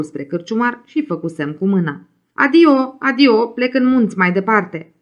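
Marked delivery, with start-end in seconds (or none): 2.3-4.9 calling out. Acted. Waving goodbye from dune top.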